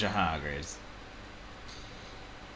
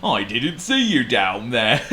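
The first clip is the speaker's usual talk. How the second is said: loud, chuckling elephant; Trumpet; 4 semitones higher, 7 dB louder than usual. A narrator's reading. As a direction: laugh through it as a big elephant. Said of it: "said Trumpet".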